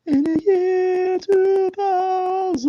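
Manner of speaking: very high, slow, quavering falsetto; the Conan skit host chanting about the future. Retold or imitated. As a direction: sing out the future like a spooky prophet. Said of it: "they had this guy go".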